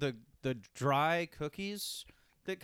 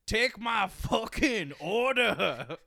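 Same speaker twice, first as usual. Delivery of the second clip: slow, louder Scottish Fat Bastard voice; mock Fat Bastard demanding order; Fat Bastard impression; 5 semitones higher, 5 dB louder, much slower than usual. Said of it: "fat bastard impersonations".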